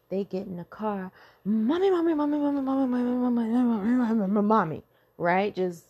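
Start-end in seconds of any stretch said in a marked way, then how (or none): 1.4-4.8 put-on high, loud child's voice, over and over; be the kid bursting into the car; a child's mommy mommy chant, much higher and louder; excited kid calling mommy.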